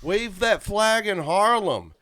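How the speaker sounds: high, drawn-out mock yell; imitating a teenage heckle from the roadside; retold yell. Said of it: "and I, I yelled".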